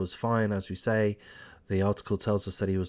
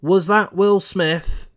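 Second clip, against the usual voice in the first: higher, louder, slow mock Chris Rock delivery; Chris Rock impression; 9 semitones higher, 9 dB louder, slower than usual. Vlogger quoting Chris Rock's joke about Will Smith, higher, louder and slow. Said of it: "Chris Rock joked".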